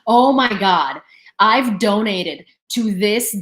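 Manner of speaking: loud, drawn-out mock gushing; mock boastful suitor. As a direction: imitate a showy donor, loud and slow. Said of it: "And they're like".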